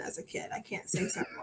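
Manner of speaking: breaks into a high laugh mid-line. Real, not acted. Guest laughing at stumbling over a word, laughing. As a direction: laugh it out, embarrassed.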